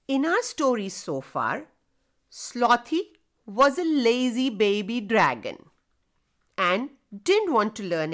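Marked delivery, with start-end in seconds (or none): none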